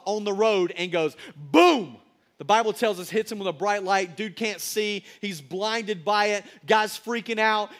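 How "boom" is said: a sudden, much louder shouted boom. Acted. Preacher booming as Jesus strikes Saul. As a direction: burst out with it like a thunderclap.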